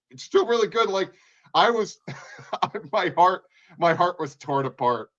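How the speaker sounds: heart-torn fan excitement; excited gushing. Real, not acted. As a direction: gush with delight. Gushing about the finale.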